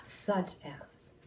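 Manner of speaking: usual speaking voice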